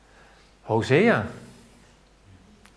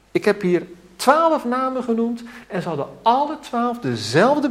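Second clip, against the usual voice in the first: raised pulpit fervour; fervent preaching; 9 semitones higher than usual. Acted. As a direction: preach it out with rising urgency. Preaching at full force.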